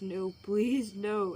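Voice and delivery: loud, mildly acted tearful plea; understated acted crying plea; pleading through tears. A narrator's reading. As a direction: beg loudly, without real sobs. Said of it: "as he's crying".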